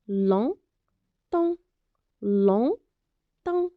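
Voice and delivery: "Longtemps" said slowly in two syllables, G and PS silent; syllable-by-syllable reading. Shown as a demonstration. Teacher models "Longtemps" for pupils, drawn out, silent letters dropped. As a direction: split "Longtemps" into lon-temps, no final consonants. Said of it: "on va lire les deux syllabes comme suit".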